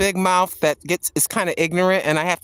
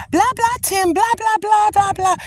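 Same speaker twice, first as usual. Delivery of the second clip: mock chatter; 3 dB louder than usual. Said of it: "if I'm".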